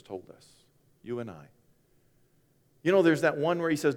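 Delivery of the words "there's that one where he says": ordinary delivery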